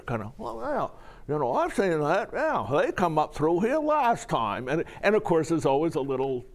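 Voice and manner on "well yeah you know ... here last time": laconic Maine storyteller; old New England accent. Retold or imitated. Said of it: "you have the detached narrator".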